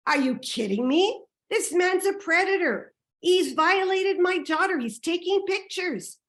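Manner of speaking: high, loud outraged accusation; indignant retort. Retold or imitated. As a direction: throw it back at her, incredulous and rising. Retold angry argument with the woman.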